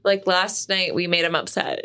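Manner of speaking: ordinary talk, nothing marked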